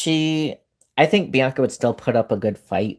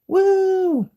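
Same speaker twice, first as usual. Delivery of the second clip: excited whoop. Real, not acted. Excited about Malaysia returning.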